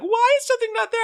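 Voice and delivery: put-on very high, whiny child's demand; complaining kid. Father imitates kids complaining to him, high whine. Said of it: "there would be a time like".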